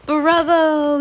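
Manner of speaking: high, loud cheer; jubilant yell. A narrator's reading. Witch audience applauding their leader, jubilant high yell. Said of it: "yelled the audience".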